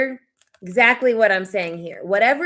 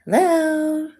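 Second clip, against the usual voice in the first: ordinary talk, nothing marked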